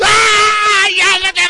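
high, full-voice holler; imitated yelling. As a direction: scream back like the raging patient. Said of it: "And he's yelling again".

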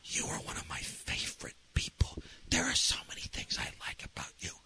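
hushed, breath-only whisper with pauses. Retold or imitated. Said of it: "And I started whispering".

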